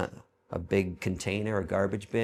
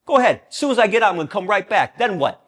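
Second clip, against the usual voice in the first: loud, high taunting at the cops; defiant yell; 11 semitones higher, 11 dB louder, faster than usual. Retold or imitated. Taunting cops at a protest, loud defiant yell. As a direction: dare them to arrest you, yelling. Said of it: "I'd bump 'em".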